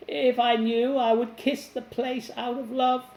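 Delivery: put-on high old woman's voice. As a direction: keep her voice up high and eager.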